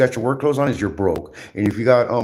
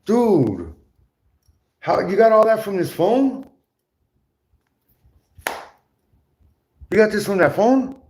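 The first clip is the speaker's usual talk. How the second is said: incredulous retort; 10 semitones higher than usual. Retold or imitated. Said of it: "I'm like".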